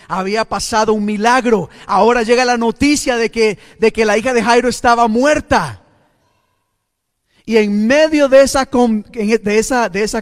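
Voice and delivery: loud, urgent build of commotion; full-force preaching. Acted. Preacher recounting the news of the daughter's death, loud and forceful. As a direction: drive the story forward loudly.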